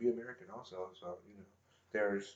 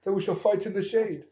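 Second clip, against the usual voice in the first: mock English accent; slower than usual. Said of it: "They got, like, English accents".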